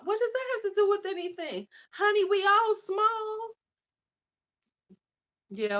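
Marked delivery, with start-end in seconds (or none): 0.0-3.5 sass the comment back playfully; sassy high exclaim. Real, not acted. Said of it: "reading and answering chat".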